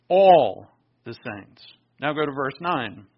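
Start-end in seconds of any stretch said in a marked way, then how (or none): 0.1-1.6 "All the saints" slow and loud, ALL punched; stress on ALL. Shown as a demonstration. Preacher to congregation, ALL the saints slow and loud. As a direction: lean hard on ALL, stretching every word. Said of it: "every word I emphasize here".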